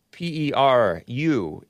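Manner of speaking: ordinary talk, nothing marked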